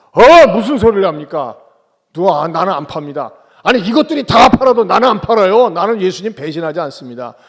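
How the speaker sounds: loud, indignant retold protest; big-talking bluster. Peter protests to Jesus that he would never betray him, loud bluster. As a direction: act out Peter loudly insisting on his loyalty. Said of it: "큰 소리 빵빵 쳤어요".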